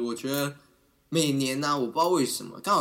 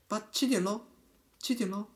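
frivolous wake-up call; 6 semitones higher, 5 dB quieter than usual. Retold or imitated. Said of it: "你是那种轻浮的".

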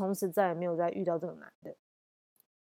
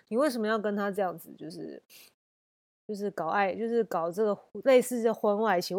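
ordinary talk

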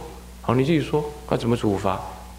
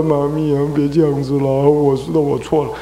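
mock-whiny plea; lightly acted anxious apology; anxious pleading child. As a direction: imitate a child begging mummy. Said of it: "你就很紧张啊".

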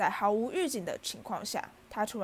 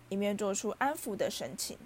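ordinary delivery

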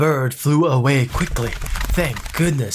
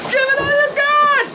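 very high, loud, fast defiant yell; defiant scream. Acted. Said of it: "The bird screamed loudly".

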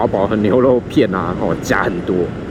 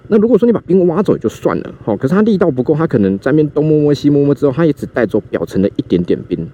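normal talking voice

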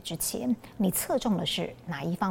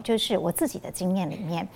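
ordinary talk, nothing marked

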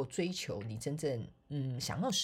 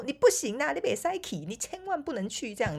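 higher mock insistent mother; imitated mother; 10 semitones higher than usual. Mother forbidding her to be a flight attendant, mock insistent. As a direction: lightly mimic a worried mother.

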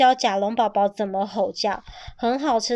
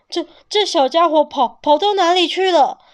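loud, high, stammering worry; frantic father searching, stumbling over words; anxious stammer; 6 semitones higher, 6 dB louder than usual. A narrator's reading. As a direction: call out in a panic for the lost child. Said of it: "霸王龙着急的东找西找".

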